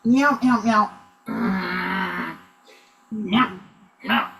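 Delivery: short, spaced-out little mews; kitty cat meowing. Pretend. Improviser gives cat clues to a guessing teammate, short meows. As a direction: mew in small bursts while working. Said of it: "but in kitty cat form".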